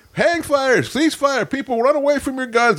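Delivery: high-pitched range warning; mock alarm call. Guest re-enacting the hang fire on the range, high urgent calling.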